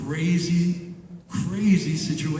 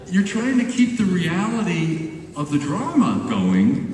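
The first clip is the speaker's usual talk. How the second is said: ordinary talk, nothing marked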